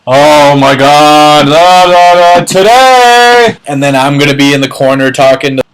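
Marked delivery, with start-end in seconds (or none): 0.1-3.6 loud, much higher squealing, slow and drawn out; hammed-up giddy partygoer; Sky's excitement. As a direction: squeal and sing-song with glee. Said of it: "Sky will be over there like".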